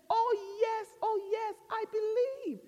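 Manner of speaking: high, hurried yeses into drawn-out begging; hammed-up desperate man; man pleading. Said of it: "And the man says".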